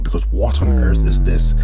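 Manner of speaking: as if you can't believe your eyes; stunned hype. Real, not acted. Hyped reaction to the fight.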